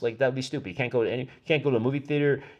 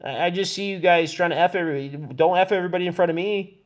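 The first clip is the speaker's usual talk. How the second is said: raised, higher-pitched fast protest; agitated scolding; 4 semitones higher, 3 dB louder than usual. Real, not acted. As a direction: call them out, voice up and urgent. Agitated warning to people around.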